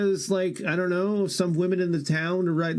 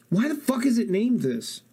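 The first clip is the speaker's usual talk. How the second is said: normal talking voice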